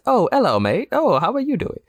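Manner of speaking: high-pitched, exaggerated British greeting; mock British accent. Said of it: "And then once camera cuts".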